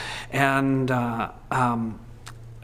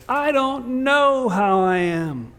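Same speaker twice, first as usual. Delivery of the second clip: slow, higher, drawn-out admission; honest uncertainty; 9 semitones higher, much slower than usual. Retold or imitated. Grieving person admits not knowing, slow. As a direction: let each word hang, unsure. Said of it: "it's perfectly all right to say".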